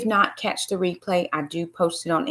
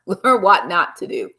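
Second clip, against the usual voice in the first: laughing; 7 dB louder than usual. Real, not acted. Repeats lesson laughing, slower.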